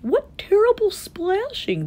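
high, slow dismay; drawn-out worried complaint; frowning elephant. A narrator's reading. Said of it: "the elephant frowned".

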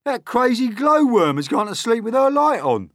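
high, loud scornful centipede voice; grumpy centipede. A narrator's reading. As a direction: snap back at the boy. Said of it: "the centipede answered".

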